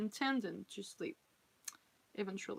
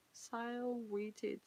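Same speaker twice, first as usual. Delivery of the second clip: ordinary delivery, nothing marked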